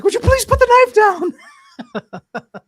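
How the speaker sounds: very high, loud mock shriek; panicked plea imitation. Frightened girlfriend to man with knife, mock panicked shriek. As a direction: imitate a desperate, screeching plea. Terrified wife begs him, retold.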